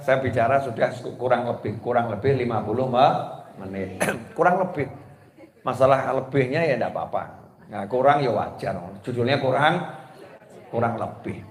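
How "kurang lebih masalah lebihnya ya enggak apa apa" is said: ordinary talk, nothing marked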